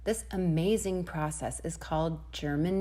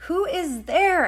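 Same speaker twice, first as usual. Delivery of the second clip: loud, much higher call; 10 semitones higher, 8 dB louder, slower than usual. Acted. Rosebud calls out, upset, loud and high. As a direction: demand it upset.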